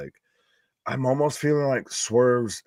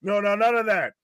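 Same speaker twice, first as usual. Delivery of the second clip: high prim scolding chaperone voice; fifties dance chaperone; 10 semitones higher than usual. Pretend. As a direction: scold the kids off the dance floor. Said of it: "I'm sounding like a nineteen fifties fucking high school dance chaperone".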